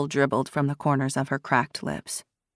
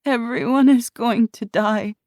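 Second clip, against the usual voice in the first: higher, louder, rising panic; panicked cry; 7 semitones higher, 4 dB louder than usual. A narrator's reading. Scared girl to Ruby, panicked outburst. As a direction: let the fear break through.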